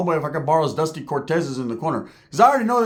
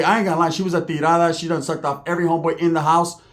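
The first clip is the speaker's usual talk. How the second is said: ordinary talk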